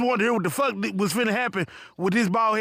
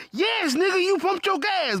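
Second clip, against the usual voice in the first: higher, fed-up retort; exasperated cashier; 8 semitones higher, slower than usual. Retold or imitated. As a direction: snap back, exasperated. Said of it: "be like".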